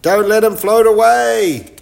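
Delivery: high and loud urgent shout; yell. Acted. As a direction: call out to stop the dog drifting off. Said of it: "yelled Chip".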